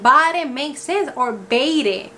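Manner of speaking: BARE said "BAH-reh", then "BAY-reh"; spelling-based mispronunciation. Shown as a demonstration. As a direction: sound out the last E of BARE. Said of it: "the B A R E, wouldn't it be".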